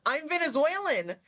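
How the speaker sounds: lightly acted eager self-introduction; retold eager reveal. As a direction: retell his reveal with a swooping lift in pitch. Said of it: "He goes".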